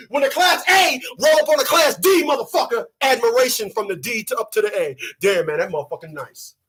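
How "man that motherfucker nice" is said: normal talking voice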